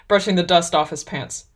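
ordinary delivery

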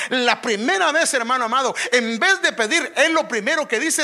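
loud, high fervent sermon voice; full-force preaching. Acted.

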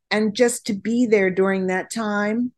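ordinary talk

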